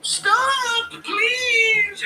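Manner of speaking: high, loud crying plea; crying Ian impression. Mocking Ian, shrill crying plea to stop. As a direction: beg through mock tears at the top of your range. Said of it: "Impression of Ian defending the Poe Doctor invasion".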